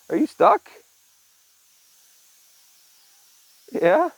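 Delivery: high loud pet call; high rising pet call; talking to the cat. Real, not acted. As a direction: sing up to the stuck cat. Calling to stuck cat.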